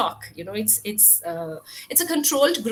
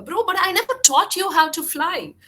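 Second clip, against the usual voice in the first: higher and louder, baffled protest; the snake can't believe it, a bit louder; puzzled snake exclaiming; 7 semitones higher, 7 dB louder than usual. A narrator's reading. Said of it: "puzzled, the snake asked".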